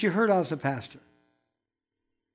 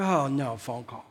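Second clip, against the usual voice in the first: low, softer grumble of annoyance; annoyed; 7 semitones lower, 4 dB quieter than usual. Retold or imitated. Preacher recalling an unwelcome phone call, annoyed. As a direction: mutter it low, put out by the interruption. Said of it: "At first I was kinda annoyed".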